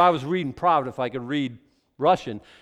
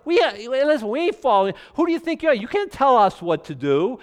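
higher, louder sneering rebel; defiant rebels; 7 semitones higher, 5 dB louder than usual. Acted. Rebellious people defying God's rule, sneering and loud. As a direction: talk back to God like a cocky rebel. Voicing rebels mocking God.

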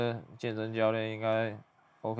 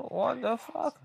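high put-on female voice; mock woman's voice; 12 semitones higher, much slower than usual. Narrator quoting the woman on screen, high mock female voice. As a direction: squeak it out as the woman would. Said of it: "这女的说".

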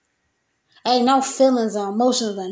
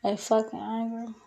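much softer, almost under breath; quiet repeat; 2 semitones lower, 11 dB quieter than usual. Real, not acted. Repeating to viewers, says it softly. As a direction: drop it to a murmur.

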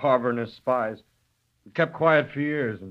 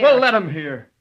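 loud, higher, defiant retort; 6 semitones higher, 6 dB louder than usual. Acted. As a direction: throw it back at her, daring them to listen. Son defies his mother.

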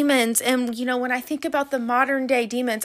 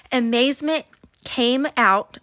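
talking around braces and rubber bands; 3 semitones higher, 6 dB louder than usual. Real, not acted. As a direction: mouth full of braces. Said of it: "I got braces and rubber bands in my mouth".

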